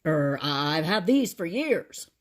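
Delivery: slow, loud flustered denial; protest, caught off guard; shocked officer. A narrator's reading. Officer defends his own teeth, shocked and flustered. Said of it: "looked shocked".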